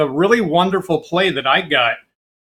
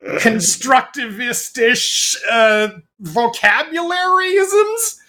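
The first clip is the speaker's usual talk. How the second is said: slow, much higher, hammed-up grand vocabulary; mock pompous words; 6 semitones higher, much slower than usual. Shown as a demonstration. Showing off elaborate words at dinner, slow mock-pompous drawl. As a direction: stretch each big word like a show-off. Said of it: "all these elaborate".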